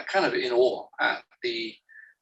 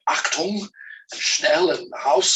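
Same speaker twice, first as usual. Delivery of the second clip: mock German accent, barked higher and louder; mock German words; 4 semitones higher, 5 dB louder, slower than usual. Author mocking German-accented clichés in novels, loud German accent. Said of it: "stuff all these German words".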